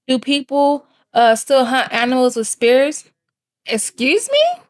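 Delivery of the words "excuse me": sharply high, affronted "Excuse me?"; offended excuse me. Real, not acted. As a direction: pretend you misheard something outrageous. Reacting to rude comment.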